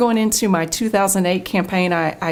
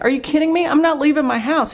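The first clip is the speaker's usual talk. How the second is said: retold high-pitched disbelief, pitched well up; reliving the shock of being asked out after the ad; incredulous refusal; 8 semitones higher, faster than usual. To her pastor, refusing to leave home, high incredulous.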